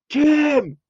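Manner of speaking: high calling shout across the street; shouting a name. Retold or imitated. Calling out to his friend Tim for help, shouting. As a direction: yell to get his friend's attention. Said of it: "shouting at Tim".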